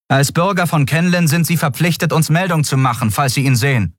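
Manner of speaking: high, formal instruction in even tones; official guardswoman. A narrator's reading. Guardswoman reminding Xea of citizens' duty, officious. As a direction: recite the regulation as an officer.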